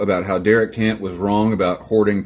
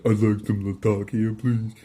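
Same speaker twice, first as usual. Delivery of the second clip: mocking customer; 5 dB quieter than usual. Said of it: "we'd be like".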